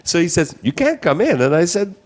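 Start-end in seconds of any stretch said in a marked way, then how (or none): 0.6-1.4 higher, hammed-up African accent for the officer. Retelling the immigration officer's refusal in a mock African accent. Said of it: "the African accent".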